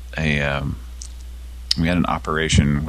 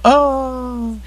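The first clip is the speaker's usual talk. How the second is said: high falsetto scream; one last high yelp; mock scream. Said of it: "then the".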